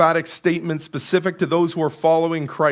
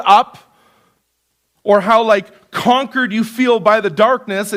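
slow, louder preaching, each phrase weighed; impassioned preaching; 3 dB louder, slower than usual. Acted. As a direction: build the image of darkness with mild fervor. Building passage on light and darkness.

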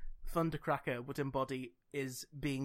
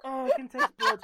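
high, loud, laughing gasp; real laughter pushing the voice up; helpless laughing; 9 dB louder than usual. Real, not acted. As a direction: squeeze it out mid-laughing fit. Helpless laughter before and after.

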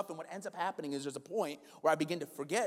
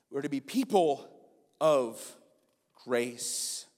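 ordinary delivery

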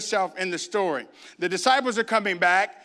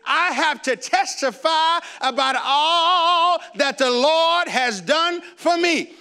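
high, drawn-out mock testimony; testifying church sister; 7 semitones higher, 3 dB louder, much slower than usual. Church sisters get up in testifying time, high drawn-out imitated testimony. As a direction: imitate a fervent church testimony, stretching the words. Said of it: "Sister Jenkins would get up and they would say".

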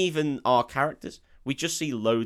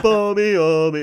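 put-on drunken slur; 9 semitones higher, 10 dB louder than usual. Mimicking drunk cricket fans.

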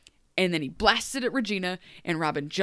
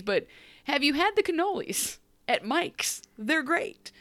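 high, whiny plea to just leave; pleading Zelina; 9 semitones higher than usual. Retold or imitated. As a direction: beg sweetly to run away to Boston. Zelina voiced pleading in recap.

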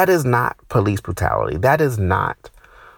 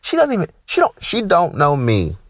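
mad outburst; 9 semitones higher than usual. Real, not acted. Comic on stage, angry at the receptionist. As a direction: blow up over a stranger's nerve. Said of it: "I'm that fucking mad".

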